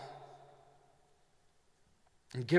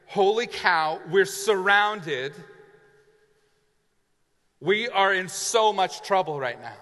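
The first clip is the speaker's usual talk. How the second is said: louder, higher alarmed cry, slowing on surrounded; alarmed realisation; 3 semitones higher, 5 dB louder than usual. Acted. Servant realising they are surrounded, alarmed. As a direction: let the panic hit you.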